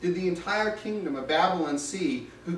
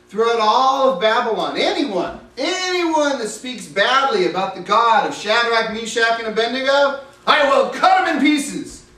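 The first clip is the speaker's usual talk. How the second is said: royal decree; 4 semitones higher, 7 dB louder than usual. Acted. King proclaims a decree.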